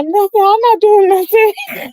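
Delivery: put-on high, laughing child voice denying it; child's denial. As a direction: play the guilty kid insisting. Nephew caught, denies it.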